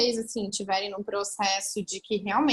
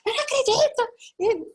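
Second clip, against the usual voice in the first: very high, squealed exclamation; excited disbelief; 13 semitones higher, 4 dB louder than usual. Retold or imitated. Friend seeing the pregnancy test, imitated excited shriek. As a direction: burst out in a thrilled high voice.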